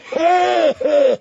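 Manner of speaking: high wheezing cackle; old man's cackle. Acted. Lord Walder cackling at Robb, high and gleeful. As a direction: cackle with glee. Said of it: "Lord Walder cackled".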